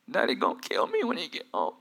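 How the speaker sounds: hushed fearful little voice; a frightened kid confessing; scared child. Acted. Boy telling mom Daddy will kill him.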